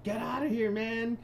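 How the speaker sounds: high frantic cry; mock panicked yell. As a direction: imitate a guy shooing a demon. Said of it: "he's like".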